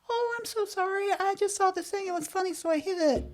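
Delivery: high, soft apologetic little voice; sorry mom voice. Retold or imitated. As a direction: mom sheepishly apologizing to her worried son, high and soft. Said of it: "she's like".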